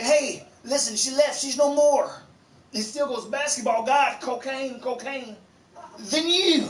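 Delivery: high, offhand coach voice; shady coach. Retold or imitated. Crooked coach telling players his wife left, high and offhand. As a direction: shrug off the breakup. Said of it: "He's like".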